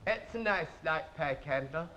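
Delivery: low, soft mock-royal "nice light pike handle"; showing how the real king speaks; king impression. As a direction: drop low and quiet to mimic the king. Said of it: "He talks like this".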